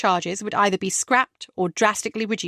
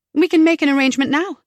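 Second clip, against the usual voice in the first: higher and louder, eager; 6 semitones higher, 6 dB louder, faster than usual. A narrator's reading. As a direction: jump at the chance brightly. Rebecca eagerly accepts date.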